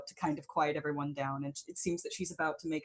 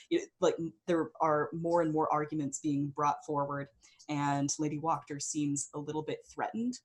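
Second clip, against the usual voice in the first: ordinary talk, nothing marked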